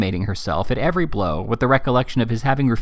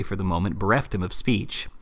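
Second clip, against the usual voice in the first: ordinary delivery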